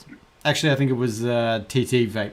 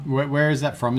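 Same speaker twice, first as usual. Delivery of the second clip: ordinary delivery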